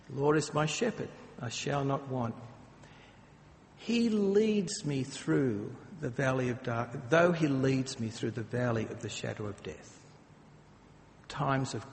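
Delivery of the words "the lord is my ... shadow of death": psalm recitation. Acted. Reciting Psalm twenty-three.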